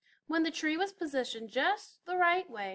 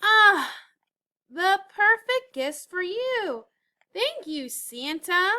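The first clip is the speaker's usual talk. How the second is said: high, loud, slowed delight; 7 semitones higher, 9 dB louder, slower than usual. A narrator's reading. Elf thanking Santa for gifts, delighted. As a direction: savour the gift happily.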